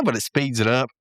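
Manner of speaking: normal talking voice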